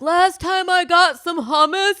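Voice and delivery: high, put-on voice mimicking her co-host; mock him in a silly high voice; mocking Moshe. Teasing her co-host in a high mocking voice. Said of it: "And you're like".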